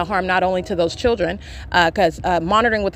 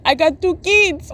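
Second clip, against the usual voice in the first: mock yell; 8 semitones higher, slower than usual. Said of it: "he's out here yelling".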